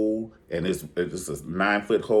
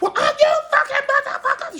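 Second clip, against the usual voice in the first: very high, louder, laughing squeal; gleeful mock squeal; 8 dB louder than usual. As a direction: squeal it out with glee like she won. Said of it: "She was like".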